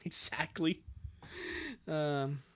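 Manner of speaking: ordinary delivery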